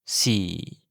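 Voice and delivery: "Si" in a low voice, sinking; low falling tone. Shown as a demonstration. Teacher modelling Thai four, low falling "Si". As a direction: let "Si" drop low like a sentence ending. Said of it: "une voix grave et une intonation qui descend".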